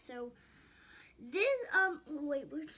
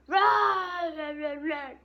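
shouting; 5 semitones higher, 13 dB louder, much slower than usual. Pretend. Toy marshmallows fleeing.

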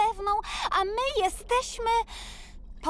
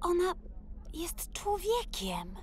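shocked whisper; 9 dB quieter than usual. Acted. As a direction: breathe it out in disbelief. Shocked discovery of a human.

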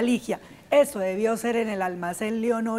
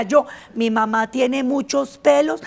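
ordinary talk

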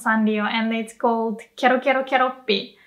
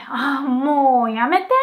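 annoyed; 2 semitones higher than usual. Shown as a demonstration. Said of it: "If someone is annoying you".

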